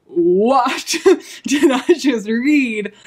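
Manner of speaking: loud, very drawn-out disbelief with a laugh; stunned reader reliving the shock; gobsmacked. Retold or imitated. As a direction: stretch each word in stunned amazement. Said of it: "I just was gobsmacked".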